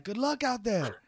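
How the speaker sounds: very high, loud cheerful wish; prince voice. Acted. Prince wishing Raz luck before the race, high and bright. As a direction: send him off like an excited young prince.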